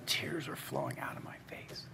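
voiceless, very soft whisper; hushed whisper. Retold or imitated. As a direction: drop to an awed whisper as you recount it. Emotional retelling of sudden tears.

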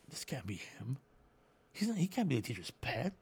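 acted-out murmur between two guards; hushed disbelief. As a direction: confer quietly, in disbelief. Guards confer in disbelief, retold.

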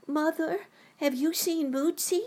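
put-on high child calling upstairs; high, loud little girl calling out; yelling across the room. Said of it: "Judy called down the stairs".

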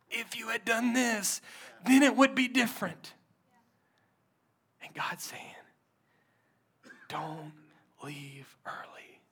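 soft, breathy, hushed sermon voice; whispered preaching. Acted. Pastor whispering to the hurting congregation, hushed whispered preaching. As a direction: lean in and whisper the promise.

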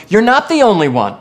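loud, high preaching declaration; emphatic reassurance. Acted. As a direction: proclaim it forcefully to lift someone up. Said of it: "He was telling him".

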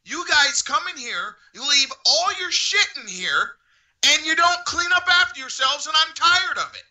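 high, worked-up rant; high-pitched indignant tirade; imitated angry staffer. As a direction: retell a fed-up employee's outburst. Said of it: "And he was like".

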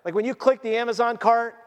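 ordinary delivery

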